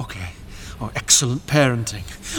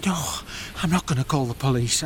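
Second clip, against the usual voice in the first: normal talking voice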